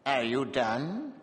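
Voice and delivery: cool dwarf. A narrator's reading. Dwarf unmoved by abuse.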